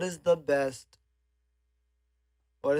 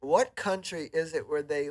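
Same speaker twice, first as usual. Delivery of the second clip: ordinary talk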